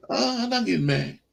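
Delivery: high, sing-song protest; high mock-sweet denial; mock innocent denial. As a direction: chirp it up high like you're totally calm. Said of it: "I said".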